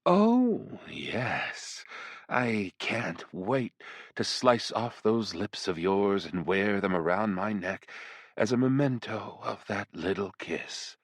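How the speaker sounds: slow, teasing demon seductress; flirtatious demoness. Acted. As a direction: purr each threat slowly and playfully. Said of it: "sounding vaguely flirtatious".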